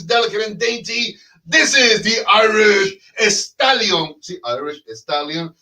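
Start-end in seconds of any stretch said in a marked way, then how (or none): 1.5-4.2 slowed, big ring-announcer build on each word; grand announcer intro. Acted. Host hypes up his co-host with a slow ring-announcer intro. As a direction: introduce him like a prizefighter, drawn out.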